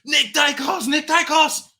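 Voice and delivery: frenzied fan chant; going nuts. Retold or imitated. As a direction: shout his name like a fan gone wild. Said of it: "I was going nuts".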